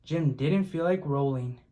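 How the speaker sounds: ordinary delivery, nothing marked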